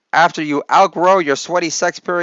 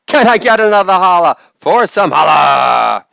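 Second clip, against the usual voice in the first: loud hype-man holler, stretched at the end; calling out; 5 dB louder than usual. Pretend. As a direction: shout it to the back of the crowd. Catchphrase call to the crowd.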